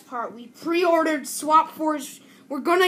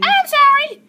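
mock apology; 13 semitones higher, 10 dB louder, slower than usual. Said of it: "don't do that mimicking voice again".

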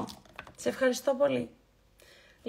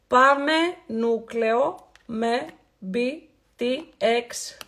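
very slow naming; much slower than usual. Acted. Slow spelled-out product naming.

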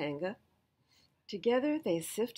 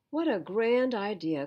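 louder, eager exclamation; cried out; 3 semitones higher, 7 dB louder than usual. A narrator's reading. Piglet delighted at the plan, cried out eagerly. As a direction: call it out with delight. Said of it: "cried Piglet".

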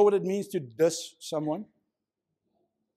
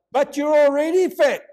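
louder and higher, a cheeky jab; heckling voice from the back; 8 semitones higher, 8 dB louder than usual. Retold or imitated. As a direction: call it out from the back of the room, blunt and loud. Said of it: "a little voice from the background pops up".

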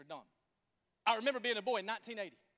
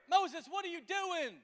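exasperated crowd; 8 semitones higher, slower than usual. Acted. Crowd confronting Moses.

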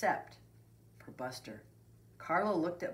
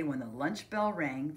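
ordinary delivery

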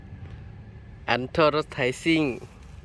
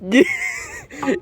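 sharp, very high burst of laughter; 3 dB louder than usual. Real, not acted. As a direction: crack up at him. Friend can't read the word. (หัวเราะแหลมสูง)

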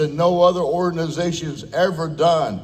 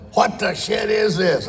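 outraged yell; 8 semitones higher than usual. Retold or imitated. Hal waking to cow pies on his lawn, shouting. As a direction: yell it like you just woke up to a prank.

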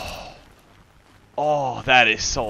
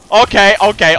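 panicked placating; 7 semitones higher, 8 dB louder than usual. Real, not acted. Placating an attacker.

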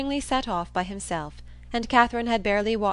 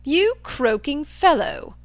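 loud, high, drawn-out jeer; blustering Thorpe mocking a doubter; scornful cry; 7 semitones higher, 8 dB louder, much slower than usual. A narrator's reading. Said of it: "cried Thorpe".

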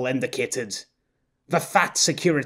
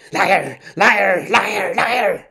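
building from muttering to a louder higher shout; rising fury; 6 semitones higher, 5 dB louder, much slower than usual. Acted. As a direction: let the rage boil over. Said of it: "his accidental muttering".

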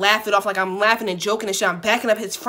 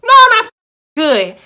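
loud, high, not good at all; exasperated shout; 12 semitones higher, 11 dB louder than usual. Real, not acted. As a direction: yell it back at the clueless manager. Replying to manager's "You good?.

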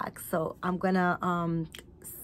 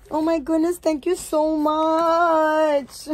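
heartfelt thanks; 7 semitones higher, 5 dB louder than usual. Real, not acted. Thanking friend for gift.

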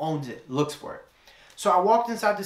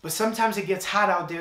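ordinary talk

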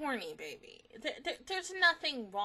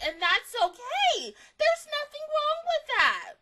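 very high, loud squeal of support; hammed-up, high-pitched encouragement; over-the-top reassurance; 10 dB louder than usual. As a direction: reassure them in a rising squeal. Hammed-up reassurance of a distracted character.

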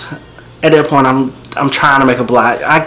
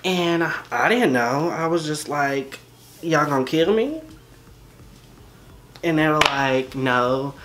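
quiet; 13 dB quieter than usual. Real, not acted. Quiet stretch, music under.

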